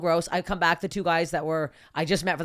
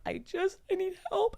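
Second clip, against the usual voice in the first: high, soft imitation of herself pleading; 13 semitones higher, 8 dB quieter than usual. Telling friends how she begged the golf cart guy, high and soft. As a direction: beg for help, small and high. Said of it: "And I'm like".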